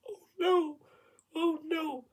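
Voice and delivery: very high, small wail of dread; mock panic. Singer caught by the director, high mock panic. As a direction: squeak it out in re-enacted terror. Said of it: "like, genuine fear, panic, terror".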